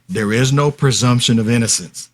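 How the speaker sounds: ordinary delivery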